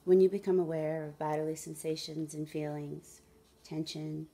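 measured meditation guide with long pauses; guided meditation. Acted. Guide asking listeners to follow the breath, guided meditation. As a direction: lead them gently through it, unhurried.

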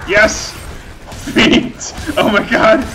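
high, loud ecstatic shouting; crowing in wild triumph; manic glee. Real, not acted. Chopping goblin feet, high loud ecstatic shouts.

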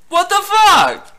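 high, slow exclamation; outraged cry. Real, not acted. Player abandoned by Segway, outraged high cry. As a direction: draw it out in loud disbelief.

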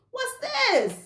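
very high, pointed "what's this"; spring the question high, catching him out; mock accusing shock. Wife confronting husband over the cooked meal, very high.

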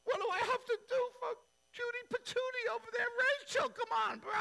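very high, fast, pleading rant; high whiny complaint. Retold or imitated. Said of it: "he's mad".

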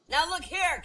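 high, loud angry outburst; acted phone-call fury; heated reply. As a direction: cut the caller off irately. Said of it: "came a heated reply".

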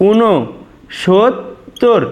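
"ঊনসত্তর" very slowly as u-no, shot, tor; slow correct pronunciation. Shown as a demonstration. Teacher showing students the correct pronunciation, slow and syllabic. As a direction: stretch "ঊনসত্তর" out in separated syllables. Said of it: "এটাই আমাদের শুদ্ধ".

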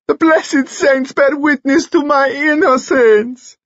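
high, slow wailing plea; sobbing. A narrator's reading. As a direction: wail it as a tearful appeal to heaven. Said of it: "he sobbed".

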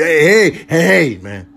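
usual speaking voice

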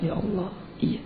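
soft, imitated weeping prayer; tearful murmur. Malik brews tea while crying, imitated tearful murmur. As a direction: quietly act out crying to God. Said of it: "nyeduh teh manis sambil nangis".

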